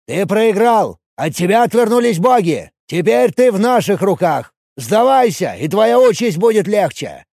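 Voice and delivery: loud, high commanding shout; shouting priest. Acted. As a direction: bark the order down at a cornered enemy. Dark priest demands surrender.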